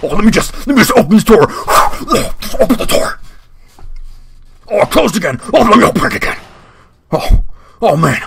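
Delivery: lightly hammed-up British rocker accent. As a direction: wander the bathroom as a hardcore British rocker. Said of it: "British rocker with, like, a weird, like, raspy voice".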